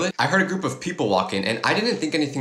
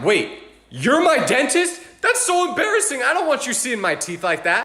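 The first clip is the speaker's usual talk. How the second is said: embarrassed whine; 10 semitones higher than usual. Retold or imitated. Embarrassed before crush.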